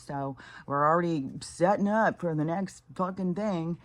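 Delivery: ordinary delivery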